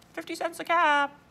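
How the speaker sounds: loud, high hawker's call; peddler's street cry. Acted. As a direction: call out the price to passers-by.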